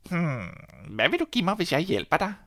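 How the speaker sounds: high sly little man; lightly voiced tricky dwarf; little man's voice.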